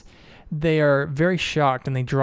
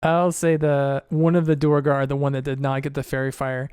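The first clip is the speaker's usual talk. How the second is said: normal talking voice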